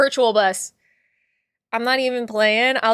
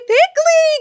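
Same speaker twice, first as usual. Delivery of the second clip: high, loud squeal of the name; excited greeting; 7 dB louder than usual. Real, not acted. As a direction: shout out Pickley with joy. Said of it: "greeting a viewer".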